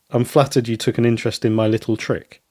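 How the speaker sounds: ordinary talk, nothing marked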